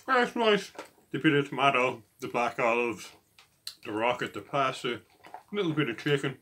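chewing between words; slightly full mouth; talking while eating. Real, not acted. Seen 1: Took a forkful at -5; picks at salad with fork throughout.

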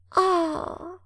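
high breathy gasp of wonder; admiring ah. A narrator's reading. Little fir elf marvelling at fireworks, awed high ah. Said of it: "machte das Tannenelfchen vor Bewunderung".

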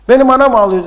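loud, high-pitched grumbling merchant; acted exasperated outburst; indignant complaint. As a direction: protest loudly at the unfairness.

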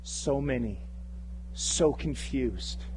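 low, slow, subdued plea to God; understated acted anguish in prayer; crying out in prayer. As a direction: pray heavily, with long pauses. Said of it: "have we cried out and said".

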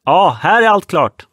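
loud, high call across the deck. Acted. Sailor shouting the all-clear between boats.

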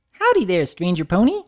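high, loud, bouncy greeting; Pinkie Pie voice. Acted. Pinkie greeting Big Mac at the counter, high and bubbly. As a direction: burst in cheerfully.

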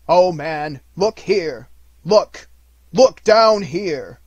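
loud, rising, slowing cry; loud exclamation. Acted. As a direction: cry out at him to look. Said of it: "exclaimed the ghost".

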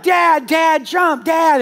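put-on high, loud child chant; kids urging. Kids urging their scared dad to jump, high loud calling. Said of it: "they're like".

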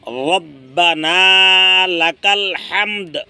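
রব্বানা with a thick, heavy র, said slowly; correct recitation. Shown as a demonstration. Teacher showing the heavy র in রব্বানা, slow. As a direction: stretch রব্বানা লাকাল হামদ slowly with a heavy র. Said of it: "তখন বলতে হবে".

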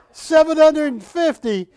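loud, high mock yell of the price; retold yell. Retelling the helper calling the price out, a loud yell. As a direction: shout the number across the room. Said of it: "She yells out".

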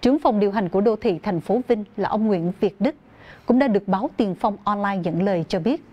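ordinary delivery

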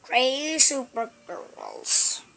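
usual speaking voice

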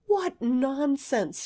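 high, drawn-out exclamation; crying out. Acted. Girl dismisses Kit calling himself coward, crying out. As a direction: cry it out slowly, pitch lifted. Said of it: "she cried".

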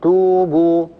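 توبوا with both oo vowels drawn out evenly; lengthened vowels. Shown as a demonstration. Teacher showing two madd waws, lengthened vowels. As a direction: hold each waw in توبوا as a long oo. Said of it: "وقابلية التطويل وقابلية الزيادة".

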